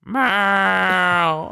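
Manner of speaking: loud, high, long-drawn meow; cat meowing. Retold or imitated. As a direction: wail it out like a pestering cat.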